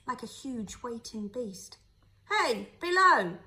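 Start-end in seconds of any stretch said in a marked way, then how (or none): 2.3-3.4 slow, loud call from above; a stranger hailing the boat from the quay, louder and higher. A narrator's reading. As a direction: call down into the hold. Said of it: "a voice called down to him".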